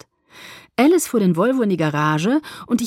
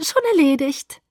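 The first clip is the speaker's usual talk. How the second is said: smug chirp; 8 semitones higher, 3 dB louder than usual. A narrator's reading. Alice grins, already done.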